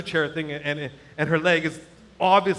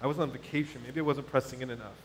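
low, soft aside to himself; inner worried thought; 5 semitones lower, 10 dB quieter than usual. Retold or imitated. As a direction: voice a private doubt under your breath. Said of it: "what's going on in my head".

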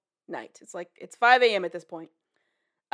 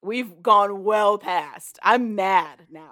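slow, louder menacing drawl; slow demon menace; 7 dB louder, much slower than usual. Retold or imitated. Demon refusing to let go, slow menace. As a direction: draw each word out like a demon.

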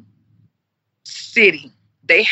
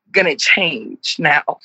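ordinary talk

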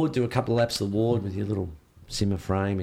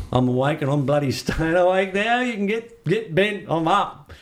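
mock-defiant outburst rising high; defiant insistence; 7 semitones higher than usual. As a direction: refuse to go back to sleep, cheeky. Defiant patient waking early.

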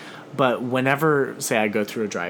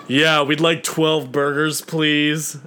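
slow, louder flat drive-thru voice; dropped monotone voice; 5 semitones higher, 6 dB louder, slower than usual. Retold or imitated. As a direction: drop the register and go monotone. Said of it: "I was hoping you'd say, like, twelve burgers".

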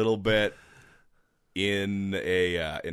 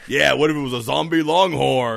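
loud hype; 5 semitones higher, 9 dB louder than usual. Acted. Loud ad pitch.

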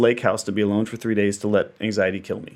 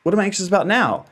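surprised question; 9 semitones higher, faster than usual. Retold or imitated. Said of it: "I was like".

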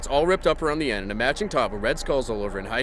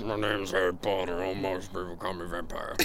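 low, muttered, sulky grumble; grumbled; 5 semitones lower, 6 dB quieter than usual. Shown as a demonstration. Redoing Harry Potter's line for friends as a low sulky grumble. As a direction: sink the voice into a sullen grumble. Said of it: "he grumbled".

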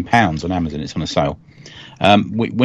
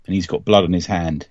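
ordinary talk, nothing marked

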